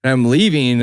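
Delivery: ordinary talk, nothing marked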